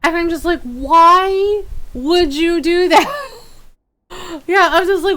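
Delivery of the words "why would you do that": high, loud, slow mock lament; exasperated wail. Streamer berating O B S for resetting, exasperated wail. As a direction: wail it, dragging out each word. Acted complaint at the software.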